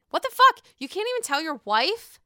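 high, loud what the fuck; outraged shout. Real, not acted. Vlogger reacting to Michael hiding things from his wife, shouting. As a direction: yell it in disbelief.

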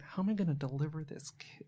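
hushed aside to oneself; soft, whispered wondering; whispered inner thought. Retold or imitated. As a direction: retell a private worry under the breath. Retelling a worried private thought.

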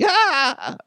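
high, loopy villain cackle; hammed-up maniacal laugh; evil clown cackle. Robot gloats over the sandwich, evil clown cackle. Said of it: "It's an evil clown".

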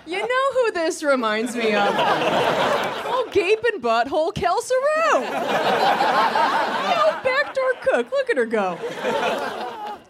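high, hammy bro voice; leering guy friend. Retold or imitated. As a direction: play a gross guy admiring porn. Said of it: "my friend Brad saw that and thought".

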